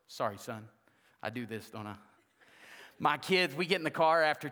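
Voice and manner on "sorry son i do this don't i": much lower and softer aside; quiet aside. Real, not acted. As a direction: mutter it to your son off the mic. Aside to son.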